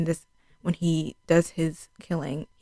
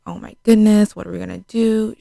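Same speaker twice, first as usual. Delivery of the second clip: loud retold panic; lightly hammed-up alarm; mock freaking out; 4 semitones higher, 8 dB louder than usual. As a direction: imitate a panicked cry for help. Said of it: "like, freaking out".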